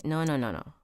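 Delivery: plain reading voice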